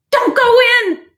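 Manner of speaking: high, loud warning cry; shouted warning. A narrator's reading. Raccoon warning Duck away from the cave, high shout. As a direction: stop them from going in. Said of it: "Raccoon shouted".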